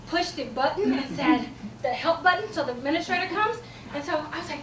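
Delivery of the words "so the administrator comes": ordinary talk, nothing marked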